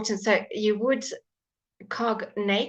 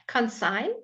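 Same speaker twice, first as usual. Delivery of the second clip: ordinary delivery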